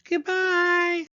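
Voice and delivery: very high tiny cub farewell; high squeaky voice. Acted. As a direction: squeak goodbye as Baby Bear. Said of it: "a high squeaky voice".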